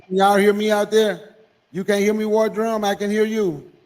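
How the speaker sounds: ordinary talk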